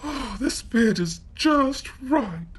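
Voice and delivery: put-on high, satisfied girl's voice; contented little girl. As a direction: sigh with relief as the girl. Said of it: "she said".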